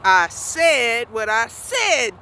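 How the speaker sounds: slow, deliberate comeback, each word landed. Retold or imitated. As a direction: stand your ground and drag it out. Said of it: "And I get louder".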